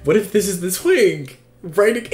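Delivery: loud, high excited repeat; escalating thrilled cry; giddy outburst. Real, not acted. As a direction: burst out with it, about to squeal. Repeats the realization, escalating into a squeal.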